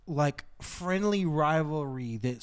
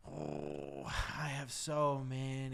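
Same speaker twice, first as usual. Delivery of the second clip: growl; 2 semitones lower, 14 dB quieter, much slower than usual. Real, not acted. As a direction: growl it softly, drawn out. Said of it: "You got that growl in 'em".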